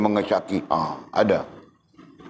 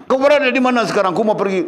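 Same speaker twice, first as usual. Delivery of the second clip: high, loud, fast demand down the phone; angry yell; 12 semitones higher, 4 dB louder, faster than usual. Acted. Jealous wife yelling at husband on the phone. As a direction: bark the question, no patience.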